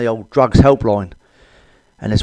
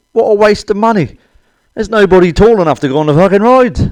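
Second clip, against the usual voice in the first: high, loud hammed-up outrage; mock outrage; 9 semitones higher, 6 dB louder than usual. Reading the paper, outraged at the Disneyland in Tokyo. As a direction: shout the punchline indignantly.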